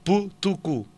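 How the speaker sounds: "Που, του, κου" as plain stops without a puff of air; unaspirated stops. Shown as a demonstration. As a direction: say "Που, του, κου" as separate short syllables. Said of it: "προφερόντουσαν, ε, τα λεγόμενα ψηλά".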